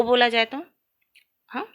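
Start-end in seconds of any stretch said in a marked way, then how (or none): none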